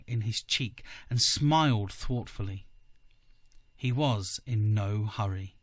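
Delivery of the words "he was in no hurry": ordinary delivery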